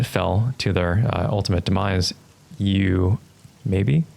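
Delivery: usual speaking voice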